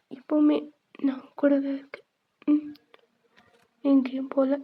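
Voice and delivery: ordinary talk, nothing marked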